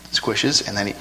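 usual speaking voice

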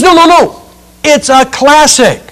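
high, insistent mock protest; protesting churchgoer. Churchgoer defending his classic book, high mock protest. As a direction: brush the question off with a high no. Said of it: "He goes".

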